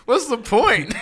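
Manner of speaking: high, slow, laughing mock sob; laughing while quoting himself crying; laughing through it. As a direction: gasp the words out between laughs. Retelling crying to dad, laughing.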